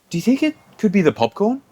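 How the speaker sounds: naive mock question. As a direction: ask it like a clueless kid. Imitating coworker's naive question.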